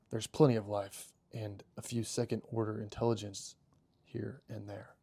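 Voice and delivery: soft, measured reading with pauses; musing robot character. Acted. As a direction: ponder it quietly as a story character. Reads robot character, subdued.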